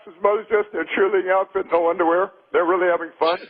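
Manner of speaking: ordinary talk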